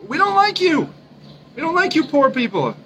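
high-pitched, put-on snobby voice; mock middle-class snob. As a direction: sneer at the poor in a high mock voice. Mocks middle class talking to poor people.

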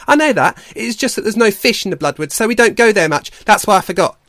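high, loud, protesting otter voice; defensive otter. Acted. Otter explains itself to doubting Leap, loud and defensive. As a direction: protest loudly all the way through.

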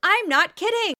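loud, high insistence; emphatic. Real, not acted. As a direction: insist it's true, loudly. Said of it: "emphatic disbelief".

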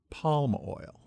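ordinary talk

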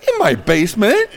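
mock self-righteous answer; indignant. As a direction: proudly put out, a bit huffy. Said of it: "all indignant and sp-, self-righteous".

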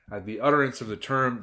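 ordinary delivery

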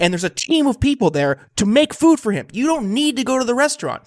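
high-pitched, incredulous indignation; worked-up and emphatic; exasperated rant. Real, not acted. Ranting about governor dining out.

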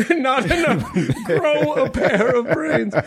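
hammed-up campaign preacher; mock preacher. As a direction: preach the punchline through a laugh. Continues the mock speech.

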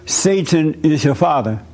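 ordinary talk

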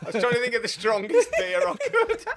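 high, squeaky talking through laughter; real giggles breaking into the words; laughing protest. Real, not acted. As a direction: defend yourself while cracking up. Laughing while defending his beer choice.

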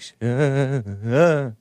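shaky-voiced stammering imitation; mock shaky voice, halting; Sean Penn. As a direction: mimic a man whose voice trembles on a stuck syllable. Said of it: "Sean Penn had the shaky voice".

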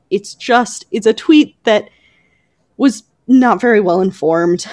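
usual speaking voice

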